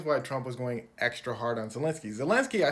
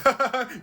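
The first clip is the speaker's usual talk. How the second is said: loud, higher mock laugh; 9 semitones higher, 9 dB louder than usual. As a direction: cackle from the back of the room. Someone in back laughing.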